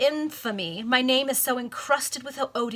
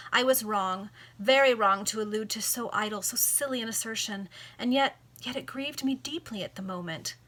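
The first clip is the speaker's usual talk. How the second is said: plain reading voice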